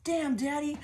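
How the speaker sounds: flirty catcall to herself. Pretend. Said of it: "She's like".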